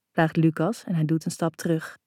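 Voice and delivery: usual speaking voice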